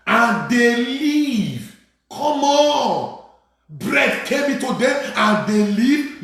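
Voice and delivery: high, drawn-out triumphant proclamation; full-force preaching. Real, not acted.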